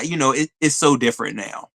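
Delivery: usual speaking voice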